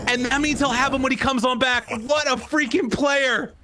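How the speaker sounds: rising hype, calling the fight live; excited play-by-play. Real, not acted.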